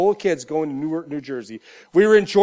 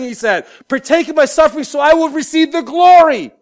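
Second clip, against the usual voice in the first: loud, high, rallying sermon delivery; preacher urging believers to share in Christ's suffering, full-force preaching; 7 semitones higher, 9 dB louder than usual. Real, not acted. Preach it out, loud and high.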